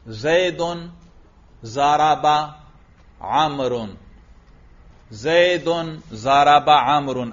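slow, word-by-word dictation; measured classroom dictation pace. Real, not acted. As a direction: draw each word out for students writing it down. Dictating a sentence to write.

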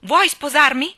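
loud, high, urgent proposal; high-pitched shouted question; shrieked. A narrator's reading. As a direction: blurt it out at a shout. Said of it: "strillò".

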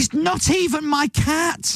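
high, drawn-out protest; slow squeal of mock outrage; comic exasperation. Exasperated rant about cats.

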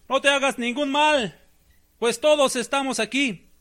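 a high urgent call to stop him; crying out. Retold or imitated. Paul calls out to the jailer about to kill himself, a high urgent cry. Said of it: "clamó a gran voz".

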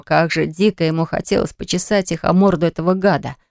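plain reading voice

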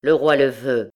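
loud, brusque verdict; curt order. A narrator's reading. Provost overcoming his weakness before the mother, curt and loud. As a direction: say it curtly, brooking no argument. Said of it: "et dit d'un ton bref".

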